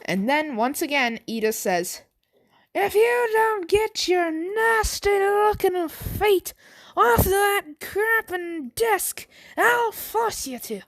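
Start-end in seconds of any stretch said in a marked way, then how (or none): 2.7-10.8 high, slow, hammed-up Irish threat; angry Irish accent. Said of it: "I'm just done doing the accents".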